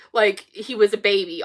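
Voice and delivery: usual speaking voice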